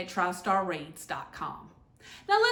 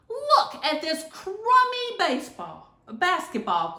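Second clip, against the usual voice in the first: complaining; 8 semitones higher than usual. A narrator's reading.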